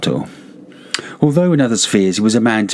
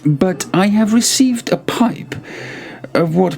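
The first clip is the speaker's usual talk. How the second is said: a hint of a higher, mock-cordial host; lightly voiced Montresor; 9 semitones higher than usual. Montresor baiting his friend with the wine, lightly voiced. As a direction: lay on the false friendliness, gently. Montresor greets Fortunato at carnival.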